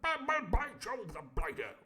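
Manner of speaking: high, stammering posh exclamation; Squire's catchphrase. Acted. The Squire blustering all the way through. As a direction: sputter it like a pompous toff. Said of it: "All the whole way through".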